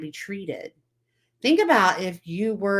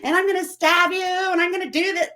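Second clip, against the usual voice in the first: put-on much higher, louder childish threat; angry kid; 13 semitones higher, 4 dB louder than usual. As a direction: play the furious bullied kid. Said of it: "And they're like".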